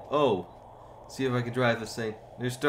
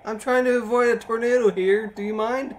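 high, loud exasperated protest; indignant; 10 semitones higher, 6 dB louder than usual. Real, not acted. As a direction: tell him off while dodging a tornado. Annoyed at laughing brother.

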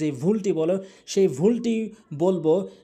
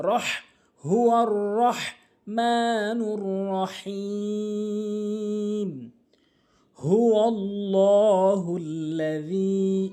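slow, drawn-out chanted recitation; Quran recitation; 3 semitones higher, much slower than usual. Shown as a demonstration. Teacher reciting the verse slowly for students.

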